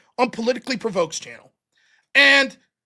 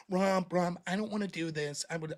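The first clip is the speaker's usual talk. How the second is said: mock meek plea; 7 semitones lower, 12 dB quieter than usual. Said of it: "when I get".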